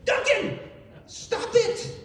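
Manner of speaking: high, loud, drawn-out scolding; mock exasperated shout. Telling how he scolded his friend for jumping in, mock exasperated shout. Acting out scolding an interrupting man.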